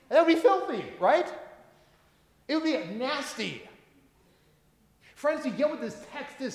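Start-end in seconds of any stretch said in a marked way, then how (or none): 0.1-3.6 mock disgust. Mock disgust at doggy treat.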